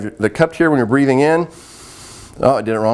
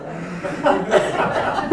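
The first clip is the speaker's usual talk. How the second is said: laugh; 8 semitones higher, 5 dB quieter than usual. Real, not acted. Laughing at contagious yawning.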